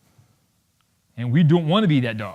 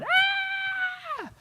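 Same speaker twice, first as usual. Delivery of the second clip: very high, held squeal; thin mock girly scream; imitated teenage scream; 6 dB quieter than usual. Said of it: "some of the teenage girls behind me are going".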